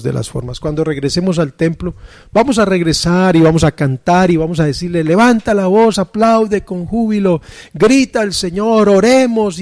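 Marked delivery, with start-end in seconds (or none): 5.0-9.6 higher-pitched call to worship; raised pitch, like exhorting a church; rousing worship leader. Acted. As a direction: lightly imitate a leader urging the congregation on. Acts out worship leader rousing congregation.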